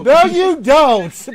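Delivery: loud, high disbelieving no; incredulous shout. Real, not acted. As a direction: shoot the claim down loudly. GM rejecting player's claim.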